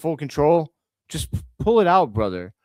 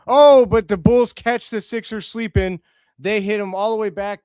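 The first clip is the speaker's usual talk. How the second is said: excited call; 5 semitones higher, 6 dB louder than usual. Real, not acted. Live play-by-play, sudden fast break.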